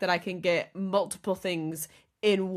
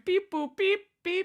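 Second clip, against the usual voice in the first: robot beeps; 10 semitones higher than usual. Retold or imitated. Imitating robot umpires.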